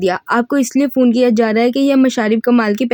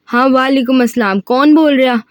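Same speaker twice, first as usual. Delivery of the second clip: ordinary delivery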